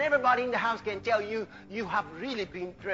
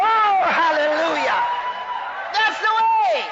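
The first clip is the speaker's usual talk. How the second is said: loud, high cry of joy; exultant praise shout; 8 semitones higher, 7 dB louder than usual. Real, not acted. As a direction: burst out in church praise. Preacher exults after demonstrating.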